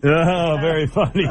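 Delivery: normal talking voice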